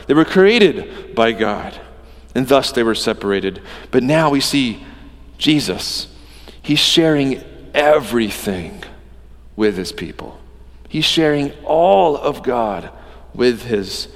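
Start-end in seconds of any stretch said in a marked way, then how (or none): none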